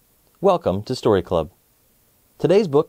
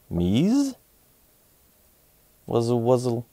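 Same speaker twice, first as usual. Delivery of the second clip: fly voice; slower than usual. Acted. Fly Guy's family shows a baby fly, buzzy voice. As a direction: buzz it sweetly.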